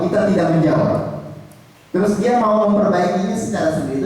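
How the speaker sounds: ordinary delivery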